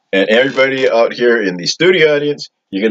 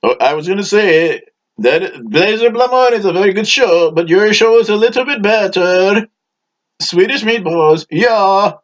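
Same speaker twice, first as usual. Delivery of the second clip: eager audience member; 10 semitones higher than usual. Acted. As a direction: pitch it way up like an eager fan in the crowd. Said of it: "what is it, Tiger Bailey?".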